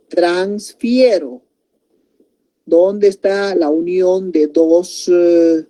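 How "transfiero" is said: "transfiero" stretched out syllable by syllable; slow example word. Shown as a demonstration. Teacher offering "transfiero" as an example, slowly drawn out. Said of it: "Otro ejemplo les puedo decir".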